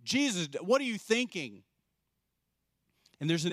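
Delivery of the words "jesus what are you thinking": loud, high panicked "whoa whoa" to stop someone; frantic objection. Retold or imitated. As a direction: rush in yelling to halt him. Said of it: "runs up to Jesus and says".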